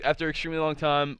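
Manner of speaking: sped-up retelling; fast. Shown as a demonstration. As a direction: rush through the phrase quickly. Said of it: "to make this go a little bit faster".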